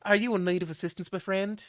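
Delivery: put-on offering help in a courtly British accent. Player character politely offers help to a struggling man, British accent. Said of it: "how I'm saying things in this British accent".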